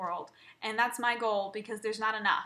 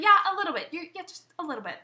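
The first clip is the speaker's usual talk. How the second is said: high, loud retort that drops off; irritated agreement, pitched up; peeved mom; 7 semitones higher, 6 dB louder, faster than usual. Retold or imitated. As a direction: imitate mom snapping back, annoyed. Peeved mom answers back, retold.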